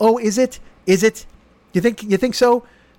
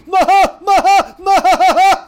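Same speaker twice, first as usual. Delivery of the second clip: much higher, louder cartoon cackle; high mock cackle; 13 semitones higher, 7 dB louder than usual. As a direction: cackle like a gloating rich villain. After the mock rich-man bit.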